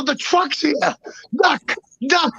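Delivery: high-pitched retold panic; psychotic panic. Hiding man warns hotel clerk, retold psychotic panic. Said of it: "I was psychosis".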